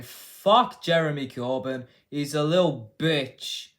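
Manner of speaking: super elongated, loud mock quote; drawn-out, emphatic mock politician; Keir Starmer. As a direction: stretch the words out slowly and loudly. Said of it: "in a super elongated way".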